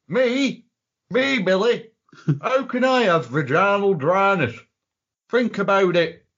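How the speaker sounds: high-pitched offended protest; imitating an affronted man; mock indignation. Acted indignant denial to Billy.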